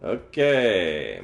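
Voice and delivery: ordinary talk, nothing marked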